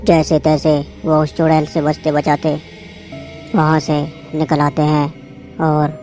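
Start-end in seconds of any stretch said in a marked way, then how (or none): none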